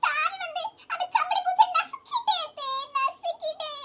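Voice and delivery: daughter-in-law fake-crying to escape blame. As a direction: sob out the excuse to win over the mother-in-law. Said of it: "அழாத".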